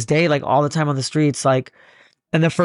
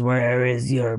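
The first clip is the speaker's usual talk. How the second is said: menacing space beast; 4 semitones lower, much slower than usual. Acted. Galaxy beast demanding where his planet is, slow and menacing. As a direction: drag it out low like a beast from space. Alien beast interrogates him.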